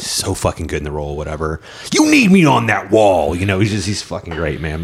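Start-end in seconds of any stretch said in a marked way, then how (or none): 1.9-3.3 movie-speech impression. Quotes the famous film speech.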